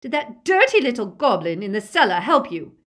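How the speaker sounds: loud, high, venomous demand; enraged scream. A narrator's reading. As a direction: scream accusingly at the prisoner. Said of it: "they heard Bellatrix scream".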